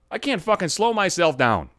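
ordinary talk

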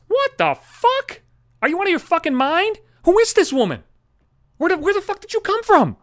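squealing what-the-fuck at the stranger; incredulous shriek. Retold or imitated. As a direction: explode in shocked outrage. Outraged disbelief at the woman.

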